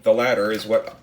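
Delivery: ordinary talk, nothing marked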